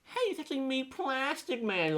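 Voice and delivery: high cartoon voice. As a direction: reveal yourself with silly pride. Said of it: "he's like".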